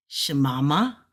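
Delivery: "shemama" rising at the end as a question; question tune. Shown as a demonstration. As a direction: ask "shemama" with a final lift. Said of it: "This is a question particle".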